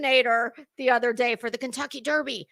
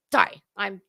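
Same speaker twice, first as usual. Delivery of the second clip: ordinary delivery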